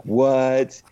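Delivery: high, loud startled what; mock surprise. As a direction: blurt it out shocked. Mock shocked reaction.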